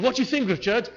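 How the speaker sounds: lightly voiced wife asking her husband; wife's question. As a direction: ask hopefully for praise. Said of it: "she said".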